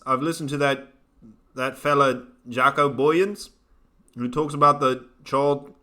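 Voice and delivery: attempted South African accent, pitch rising as it goes. Shown as a demonstration. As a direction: put on your best South African accent. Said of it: "That was my best South African".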